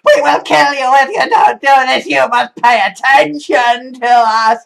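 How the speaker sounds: high, loud, stretched mock shout; mock screaming bureaucracy. Universal Credit screaming threats in the speaker's face, hammed-up shout. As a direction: yell it like a faceless agency threatening you. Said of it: "screaming in my face, going".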